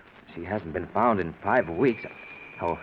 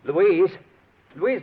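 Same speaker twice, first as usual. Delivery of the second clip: calling out; 9 semitones higher, 6 dB louder than usual. Acted. Husband calls out for wife.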